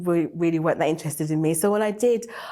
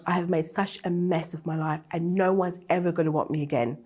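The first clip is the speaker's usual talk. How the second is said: normal talking voice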